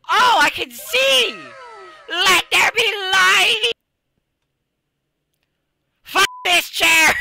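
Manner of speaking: high, loud, slow theatrical yells; hammed shouting. Real, not acted. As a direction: proclaim it like a grand announcement. Excited outbursts while playing.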